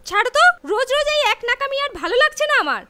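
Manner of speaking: fed-up angry snapping; loud and fast, sick of it. Acted. Wife snaps at drunk husband.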